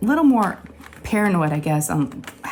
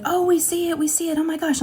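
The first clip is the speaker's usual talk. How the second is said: put-on much higher, gasping girls' voices; excited girls; 11 semitones higher than usual. Daughters spotting the chipped tooth, excited. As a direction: play the kids seeing it.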